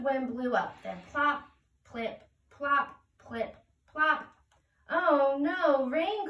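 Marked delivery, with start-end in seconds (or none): 4.9-6.3 higher, louder sudden alarm; alarmed cry. Acted. Ant crying out as the rain begins. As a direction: cry out at the first raindrops. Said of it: "cried Ant".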